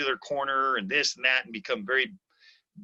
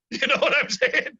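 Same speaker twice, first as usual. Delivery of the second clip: laughing, higher and louder; 13 semitones higher, 4 dB louder than usual. Real, not acted. Coach laughing with the host at his own example. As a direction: laugh it out at the end.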